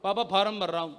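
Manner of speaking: son's casual reply. Retold or imitated. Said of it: "ये क्या बोल रहा है?".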